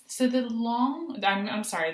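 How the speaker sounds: normal talking voice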